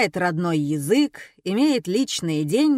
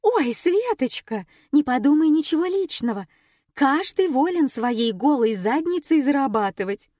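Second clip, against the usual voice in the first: mock sweet; 6 semitones higher, 3 dB louder than usual. Nina feigning sympathy.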